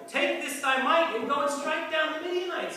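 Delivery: a lightly voiced God calling out to Gideon; God's greeting. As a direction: hail him as God would. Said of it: "He told him".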